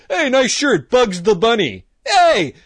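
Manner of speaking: high, loud mocking taunt. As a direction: tease him high and loud. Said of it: "he wouldn't say".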